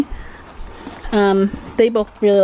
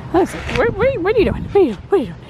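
puppy talk; 8 semitones higher, much faster than usual. Real, not acted. Talking to the puppy on walk.

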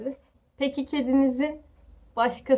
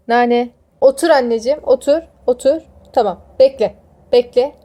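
louder firm pet commands; firm commands to a cat; 9 dB louder than usual. Real, not acted. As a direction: tell the cat to sit and wait.

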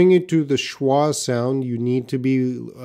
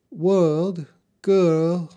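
British accent with higher pitch, R dropped; unconvincing British accent, R-less vowels; attempted British accent; 6 semitones higher, 4 dB louder than usual. Shown as a demonstration. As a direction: have a go at a British "world, girl". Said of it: "I can't do the British accent".

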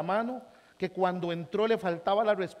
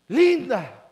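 loud call; 5 semitones higher, 12 dB louder than usual. Retold or imitated. Retold greeting, loud quote.

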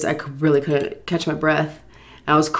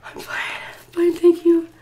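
breathless reply; 13 semitones higher, slower than usual. Retold or imitated. Crying woman reassuring a passer-by, gasping. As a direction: whisper breaking into a high squeak. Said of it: "didn't have enough breath in me".

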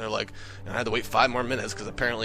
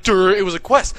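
loud, higher dopey retort; mocking duh; 6 semitones higher, 8 dB louder than usual. [name] brushing off the gamer's protest, mocking and loud. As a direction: answer as if it were obvious, mockingly.

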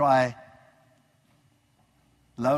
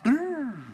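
higher lip-trill brrr of astonishment; a quick trilled brrr for eyes popping; mock amazement brrr; 6 semitones higher than usual. Listener stunned by the carpenter's Bible teaching, brief higher lip-trill. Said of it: "my eyes went".

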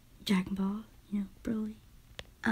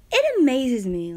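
louder, slow, breathy wonder; gushing awe; 4 semitones higher, 9 dB louder than usual. Real, not acted. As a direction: marvel out loud. Gushing over the actor's voice.

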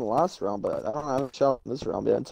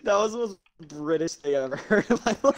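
laughing; 11 semitones higher than usual. Real, not acted. Laughing through remark.

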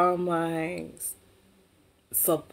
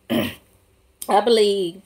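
usual speaking voice

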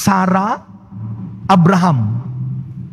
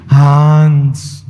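lightly voiced frail old woman, slow; a hint of a weary old grandmother asking slowly; old lola; 5 semitones lower, 3 dB louder, much slower than usual.

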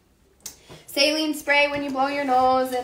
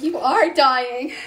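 mom's shocked exclaim; 3 semitones higher, 6 dB louder, much slower than usual. Retold or imitated. Mom hears her lost voice.

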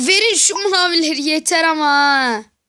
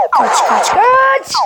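excited yell; 6 semitones higher, 4 dB louder, much slower than usual. Real, not acted. Fleeing in game, yelling run.

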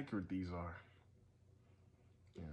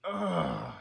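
disgusted groan; 9 semitones higher, 7 dB louder than usual. Real, not acted. Still disgusted.

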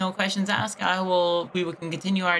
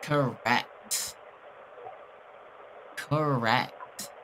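lower, softer smug echo of "Correct"; mocking echo; 8 semitones lower, 8 dB quieter, much slower than usual. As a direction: throw his word back at him, low and smug. Mocks the scammer's reply.

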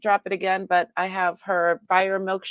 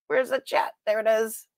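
ordinary talk, nothing marked

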